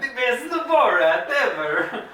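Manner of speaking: high, loud caricature, stretched out; Borat. Acted. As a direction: belt out a slow, hammed-up comic accent through laughter. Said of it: "going to be Borat impressions".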